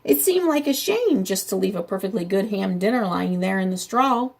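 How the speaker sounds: usual speaking voice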